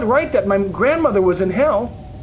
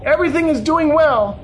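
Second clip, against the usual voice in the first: high, loud answer; urgent calling back; 9 semitones higher, 5 dB louder than usual. A narrator's reading. Answering grandmother in the snake's coils, urgent calling. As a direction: call it back, voice raised high.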